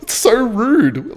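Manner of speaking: laughing, slowed and disbelieving. Real, not acted. Laughing at the rude woman, amused disbelief. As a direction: laugh it out slowly.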